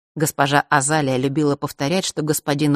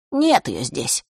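confident; 2 semitones lower, slower than usual. A narrator's reading. Erik by the curtain tells his brother, confidently. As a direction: state it with mild assurance. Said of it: "уверенно произнёс Эриков голос".

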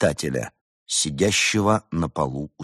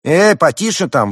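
loud, high protest; offended outburst, pitched up; indignant; 10 semitones higher, 7 dB louder than usual. A narrator's reading. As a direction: shout back in indignation. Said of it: "возмутился Эдуард".

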